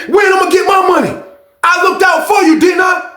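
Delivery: high, loud yelling at his cellmate; imitated loud yelling. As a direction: bark the demands like a short angry inmate. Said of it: "he had a loud voice".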